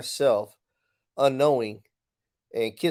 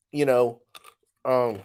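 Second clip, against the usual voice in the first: talking while crunching ice; words around a mouthful of ice; chewing ice. Real, not acted. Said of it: "I'm chewing ice".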